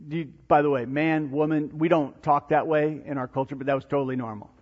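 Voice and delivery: ordinary talk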